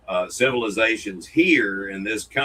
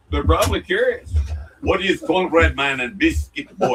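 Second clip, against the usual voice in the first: mock Russian accent, higher and a bit louder; 5 semitones higher, 5 dB louder than usual. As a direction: put on a jokey Russian accent. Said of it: "that was a Russian accent".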